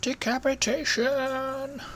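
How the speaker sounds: high, slow dramatic announcement; dramatic announcer. Acted. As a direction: ham it up like a game announcer. Announcing kill in game.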